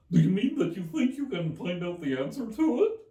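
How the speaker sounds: high, loud hare voice; March Hare voice. Acted. March Hare questioning Alice, high and loud.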